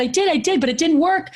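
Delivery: mock exasperated protest; frantic insisting. Frantic mom tells the neighbor the blowing failed.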